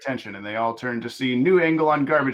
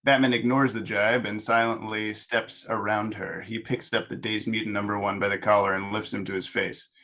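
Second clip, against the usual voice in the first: normal talking voice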